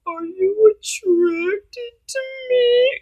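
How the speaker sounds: high, long-drawn whine; whiny mock pleading. Date begging to be found attractive, exaggerated whine. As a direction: imitate a needy man whining, stretching every word. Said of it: "that whiny little bitch shit".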